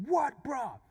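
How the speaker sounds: high, loud schoolyard provocation; confrontational challenge. Retold or imitated. Somebody on the schoolyard starts trouble, confrontational challenge. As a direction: call it out high, spoiling for a fight. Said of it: "never gonna lead to anything positive".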